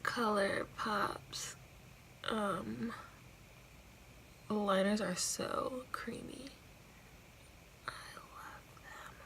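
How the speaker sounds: slow soft whisper. Real, not acted. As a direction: whisper it slowly. Whispered narration run.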